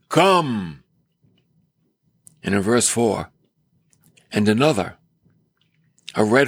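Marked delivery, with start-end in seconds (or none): 0.1-0.8 understated commanding cry. Acted. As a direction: call it as a brief summons. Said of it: "the living creature cries out".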